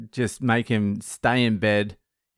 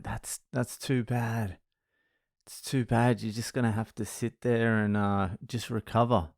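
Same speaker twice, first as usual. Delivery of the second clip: mock sympathy; 7 dB quieter than usual. Dad feigns sympathy, refusing son's plea.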